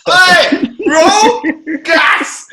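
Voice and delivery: high, loud mock outcry; laughing outcry. Telling friends, high loud hammed-up outcry. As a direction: keep the hammed-up yelp going.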